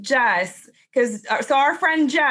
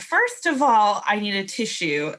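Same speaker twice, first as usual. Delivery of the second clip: usual speaking voice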